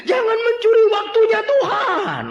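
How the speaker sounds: very high, loud laughing command; laughing shout. Acted. Preacher laughingly shouts at latecomers. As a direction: yell it through laughter.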